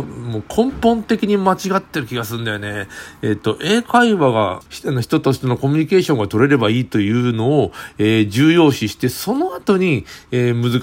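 ordinary talk, nothing marked

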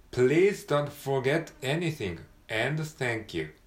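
slow, separated words in "Please don't forget anything"; slow model reading. Shown as a demonstration. Teacher reading the full line slowly. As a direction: read it slowly for learners. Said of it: "通して読んでみましょう".

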